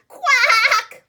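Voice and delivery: loud, high quack; laughing quack. A narrator's reading. Duck laughing with a loud high quack. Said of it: "laughed the duck".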